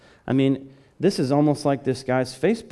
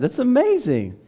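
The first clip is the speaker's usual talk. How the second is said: very high, loud exclamation of wonder; amazed; 12 semitones higher, 5 dB louder than usual. Shown as a demonstration. Preacher urging wonder at flight, amazed. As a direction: burst out in full amazement. We often lose our excitement.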